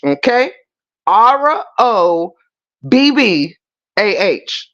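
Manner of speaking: ordinary talk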